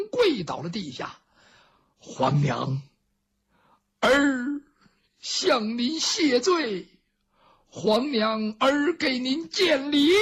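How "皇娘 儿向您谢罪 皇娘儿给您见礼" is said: slow, drawn-out filial plea; stately, overwrought son begging forgiveness; solemn emotional apology. Acted. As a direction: stretch each phrase, rising high in repentance. Emperor kneels before long-lost mother.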